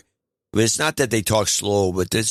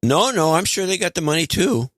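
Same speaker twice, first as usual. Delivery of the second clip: put-on a light Canadian accent, higher; playful Canadian accent on the money; 9 semitones higher than usual. Co-host teases the spending in a light Canadian accent. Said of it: "you actually did a Canadian accent".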